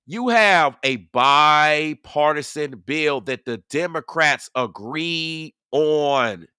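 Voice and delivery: very slow, drawn-out key words; emphatic reiteration. Real, not acted. Streamer reiterating a point to the chat, slow and emphatic. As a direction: spell it out slowly, leaning on each phrase. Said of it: "let me reiterate this".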